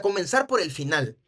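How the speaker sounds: ordinary talk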